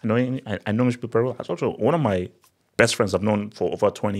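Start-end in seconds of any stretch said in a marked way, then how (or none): none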